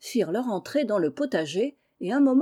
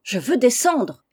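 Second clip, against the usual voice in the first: slow, raised cry of protest; 4 semitones higher, 6 dB louder, slower than usual. A narrator's reading. Félicie demanding to get off the cart, crying out. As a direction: cry out higher and louder, stretching the demand. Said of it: "cria encore Félicie".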